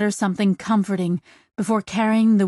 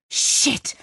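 voiceless, forceful expletive; tense whispered swear; hissed curse. Acted. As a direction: spit it out under the breath. Pilot curses as ship hurtles.

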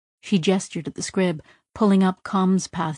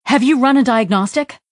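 higher, louder sharp question; urgent loud demand; snapping; 6 semitones higher, 7 dB louder than usual. Acted. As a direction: bite the question out. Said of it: "Pepper snapped back to Sidra".

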